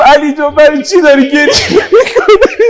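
tearful cry climbing into a wail; wailing lament. Real, not acted. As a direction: build to a sobbing call of grief. Mourning eulogy, dying Fatima's words.

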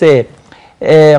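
usual speaking voice

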